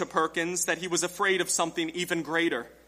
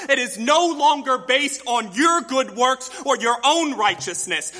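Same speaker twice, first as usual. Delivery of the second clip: preaching at full force; 4 semitones higher, 3 dB louder than usual. Acted. Rising preaching passage.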